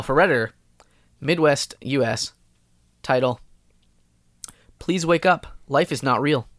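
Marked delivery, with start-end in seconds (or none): none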